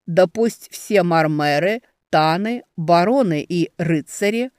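loud, slow formal announcement; herald's proclamation. Acted. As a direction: proclaim it over the crowd. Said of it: "Он говорил это чётко и громко".

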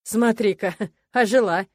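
mock her with a chuckle; snide. A narrator's reading. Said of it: "ехидно заметил Норан". (ехидно, с усмешкой)

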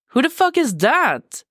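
sharp, high, stunned demand; shocked outburst. Acted. Con catching sight of the text, shocked outburst. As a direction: Con blurting it out in disbelief.

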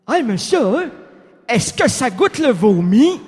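lightly voiced ghostly philosopher; Blaise Pascal voice. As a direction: play the dazed Pascal. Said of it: "la voix de Blaise Pascal".

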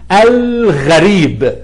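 الغريب slowly with a held l, "al-gharib"; moon lam. Shown as a demonstration. Teacher giving another example, l sounded in الغريب. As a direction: stretch the l in الغريب before the gh. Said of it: "برضو اللام ساكنة".

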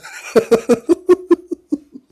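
very high shrieking laugh; high burst of laughter. Real, not acted. Laugh after mock prayer.

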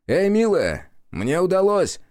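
high, loud call to another room. A narrator's reading. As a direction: call her from the kitchen. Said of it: "позвал он Нору".

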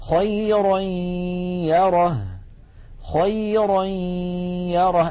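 خيرا يره chanted slowly with a sustained nasal y joining the two words; ghunna and ya together. Shown as a demonstration. Teacher demonstrating tanween before ya, nasal held glide in خيرا يره. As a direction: hum through the nose on the y between خيرا and يره. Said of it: "غنة وياء في آن واحد".

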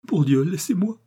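pitched high, a lightly sobbing plea; through sobs. A narrator's reading. Weeping widow begging the young man to leave, mild sobbing plea. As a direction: beg to be left alone, a touch of tears in it. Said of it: "murmura-t-elle à travers ses sanglots".

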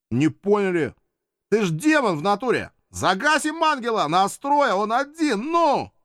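high, loud street-thug urging; pushy thug. Acted. Imp thugs urge the demon to gang up, high and loud. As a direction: press him like a gang punk.

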